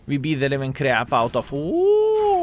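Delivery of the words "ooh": a sudden high squeal of "ooh"; surprised ooh. Real, not acted. Reacts to a hit in game.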